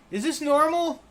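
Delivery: very high, loud goblin squeak; goblin question. Acted. [name] asks his friend in Goblin, very high and loud. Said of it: "He's just like".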